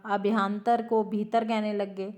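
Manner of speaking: ordinary talk, nothing marked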